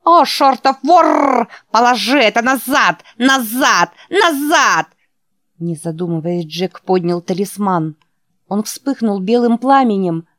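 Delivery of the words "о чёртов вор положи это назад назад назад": high, loud harsh buzzing screech; buzzy creaking spider voice. Acted. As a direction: screech it out like an angry insect. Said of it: "своим резким жужжащим голосом".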